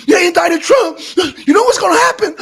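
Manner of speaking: high, loud frightened cry; mock alarmed fan. Mocking fans warning what an indictment brings, in a loud frightened cry. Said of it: "You don't know how many people".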